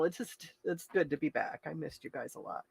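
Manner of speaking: very soft, fading away; emotional. Real, not acted. Host telling viewers they were missed, emotional and very soft. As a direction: let the voice drop away with emotion. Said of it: "I don't mean to be emotional".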